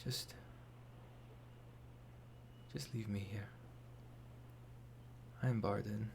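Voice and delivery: weak whisper from the floor; barely able to speak, lying down; collapsed on floor. Acted. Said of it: "you hear from the other side of the door".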